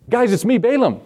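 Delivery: high, eager plea of a doomed man; pleading Balaam. Acted. Balaam calling to the soldiers at his tent, high and pleading. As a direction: call out brightly, begging to be spared.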